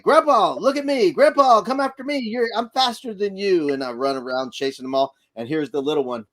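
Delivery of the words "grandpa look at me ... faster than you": put-on high, loud kids' call across the room; excited kids calling. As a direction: call out like a child playing chase. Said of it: "and they're like".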